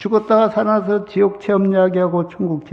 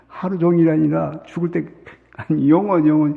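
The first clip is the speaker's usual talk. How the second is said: ordinary delivery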